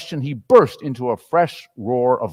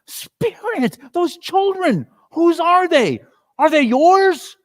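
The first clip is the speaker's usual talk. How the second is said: put-on high, urgent old man's cries, louder at the end; horrified plea; 5 dB louder, slower than usual. Scrooge begs the Spirit about the wretched children, high and alarmed. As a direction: cry out in horror at the children.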